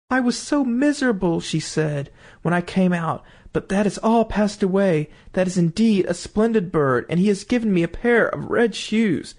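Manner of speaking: a hint of a delighted child; cheerful little girl. Marlene happy with new shoes.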